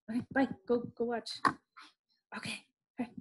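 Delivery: softly shooing, away from the mic; turning away from phone. Real, not acted. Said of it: "Sorry, that was my daughter".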